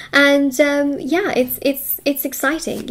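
ordinary talk

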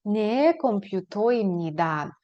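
Не комп'ютоємніда slow and falling; slow model answer. Shown as a demonstration. Teacher drills the answer slowly for students. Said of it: "ви скажете".

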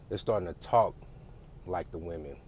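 ordinary delivery

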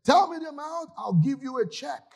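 hammed-up offended husband; upset man. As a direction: throw the offer at her, stung. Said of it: "the man was now upset".

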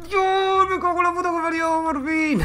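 high loud yelling of joy; triumphant scream. Real, not acted. Triumph after long struggle.

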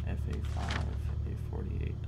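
quietly whispering a code to memorize it. Real, not acted.

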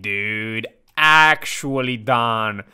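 slow, low, drawn-out disbelief; stunned and done. Real, not acted. Player giving up over the partner's luck, slow and low.

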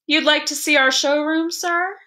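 smooth, innocent-sounding offer; innocent suavity. A narrator's reading. Shopman inviting the father to the showroom, smoothly innocent. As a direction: coax them politely into the showroom. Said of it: "with an innocent suavity".

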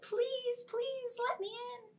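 very high please; high begging. Acted. Sparrow begging, very high. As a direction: beg like a tiny bird.

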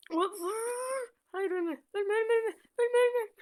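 high, loud panicked pleading; panicked yelling. Real, not acted. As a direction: beg the character not to die. Panicking as character dies.

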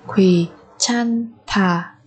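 very slow "Quý chan thả"; syllable by syllable. Shown as a demonstration. Teacher modelling a word for learners, very slowly.

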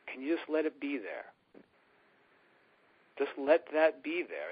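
ordinary delivery

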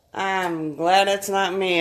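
ordinary delivery

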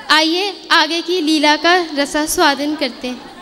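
normal talking voice

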